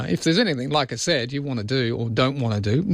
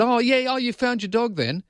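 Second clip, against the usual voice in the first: lightly voiced cheerful neighbour, pitched higher; a hint of a chatty village lady; mock neighbour voice; 8 semitones higher than usual. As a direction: play the helpful neighbour, up high. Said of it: "this woman said".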